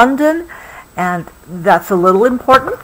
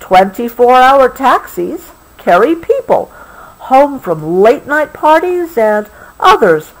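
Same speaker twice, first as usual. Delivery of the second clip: bright storybook reading, a little louder; story reading; 4 semitones higher, 4 dB louder than usual. A narrator's reading. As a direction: read it cheerfully to a child. Reading book text.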